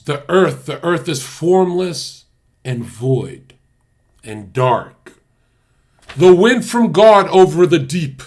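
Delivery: slow, loud dramatic Bible reading; a lightly preachy scripture recital. Acted. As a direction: read it like a booming preacher. Reading Genesis aloud.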